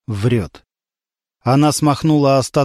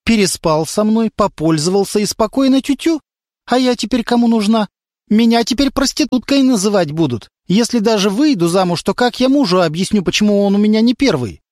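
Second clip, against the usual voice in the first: put-on girl accusing; 7 semitones higher than usual. A narrator's reading. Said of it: "говорила девушка".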